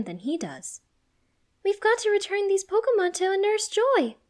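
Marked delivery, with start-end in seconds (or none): 1.6-4.2 high, loud urgent order; commanding. A narrator's reading. Serena taking charge of the rescue, commanding. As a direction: take charge in a raised, high voice. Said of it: "Serena commands".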